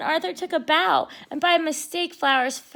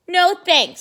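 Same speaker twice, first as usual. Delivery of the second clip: gruff bully; 6 semitones higher, 9 dB louder than usual. Acted. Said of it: "said Binky".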